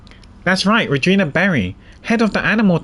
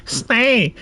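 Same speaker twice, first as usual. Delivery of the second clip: higher, nasal command to the elephant; nasal animal tamer; 5 semitones higher than usual. Acted. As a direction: call the elephant to stop in a nasal girl's voice. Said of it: "let's give her a nasal one".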